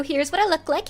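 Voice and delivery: usual speaking voice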